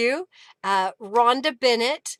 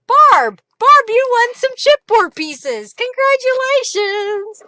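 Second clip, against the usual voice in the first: high, loud celebratory cheer; excited winner announcement; 12 semitones higher, 9 dB louder, slower than usual. Real, not acted. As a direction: shout the winner's name, then stretch the congratulations.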